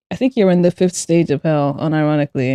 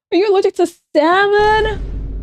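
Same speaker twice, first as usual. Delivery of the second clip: way higher, disbelieving; shocked high question; 12 semitones higher than usual. Real, not acted. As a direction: ask it in high alarmed surprise.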